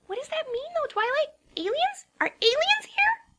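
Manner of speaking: high, rising alarm about aliens; breathless high excitement; excited Pinkie. A narrator's reading. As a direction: squeak it out wide-eyed. Pinkie voiced, wide-eyed.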